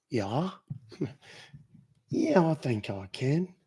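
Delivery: soft, slow Australian; easygoing Aussie drawl; Aussie accent. Shown as a demonstration. Streamer answering a viewer's request, soft Aussie accent. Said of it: "Can you do an Aussie accent".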